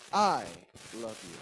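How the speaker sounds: heavy emphasis on the first word, "I"; stress on I. Shown as a demonstration. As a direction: punch "I" hard, then drop for the rest. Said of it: "Let me put an emphasis".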